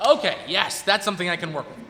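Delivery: loud, high burst of relief; relieved excitement. Real, not acted. Host thanks the crowd for the bobcat idea, relieved and loud. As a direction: grab the suggestion gladly. Audience gives the answer.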